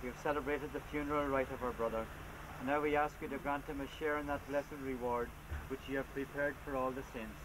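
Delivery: ordinary delivery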